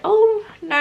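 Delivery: sudden high, loud "oh, no"; startled exclamation, jumps up; surprised midwife. Retold or imitated. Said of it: "and she said".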